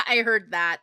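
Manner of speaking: loud laughing fit around the words; laughing at herself. Real, not acted. Laughing at how her own words sounded. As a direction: crack up at your own slip. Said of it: "How that sounded".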